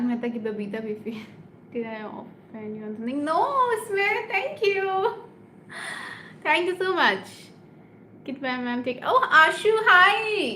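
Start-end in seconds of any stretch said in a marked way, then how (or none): 3.3-7.3 high, drawn-out grateful squeal; effusive, high-pitched thank-you; gushing thanks. Real, not acted. 9.1-10.6 high, loud, stretched-out hello; squealed hello to a friend; excited greeting. Real, not acted. Spots and greets a viewer.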